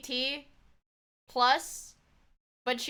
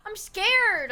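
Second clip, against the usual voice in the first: high, loud, drawn-out fear; scared whine; 8 semitones higher, 6 dB louder than usual. Real, not acted. Streamer facing a mystery object, scared high whine. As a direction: wail that you don't dare.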